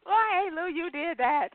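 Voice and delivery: high, emphatic hype; excited praise. Real, not acted. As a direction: hype her up, you nailed it. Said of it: "praising the poem".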